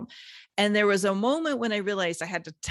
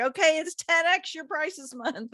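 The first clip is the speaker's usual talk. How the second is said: much higher-pitched, breezy quoted dare; playful challenge; 10 semitones higher than usual. Retold or imitated. Coach re-enacting a challenge to clients, playfully upbeat. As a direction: toss out a teasing challenge in a raised voice.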